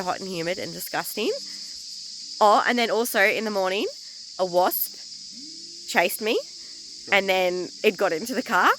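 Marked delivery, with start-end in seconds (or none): none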